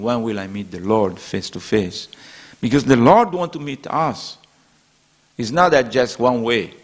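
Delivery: ordinary talk, nothing marked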